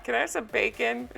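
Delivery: softly repeated, tickled imitation; amused quiet requote. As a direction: quote it softly, just after a laugh. Repeats the quote, amused, after laughing.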